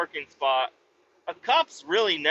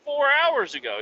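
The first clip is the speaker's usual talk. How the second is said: pitched-up incredulous snap; exasperated; 12 semitones higher than usual. Retold or imitated. Telling the cop how long he waited, in high exasperation. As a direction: throw it back at him, high and fed up. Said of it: "I was like".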